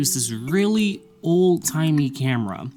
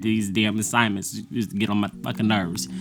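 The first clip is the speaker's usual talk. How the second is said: ordinary talk, nothing marked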